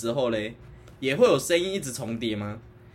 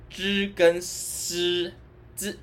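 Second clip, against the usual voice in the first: reading aloud haltingly; 2 semitones higher, much slower than usual. Shown as a demonstration. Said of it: "我只有知跟，知跟诗跟 滋跟 诗 不会分".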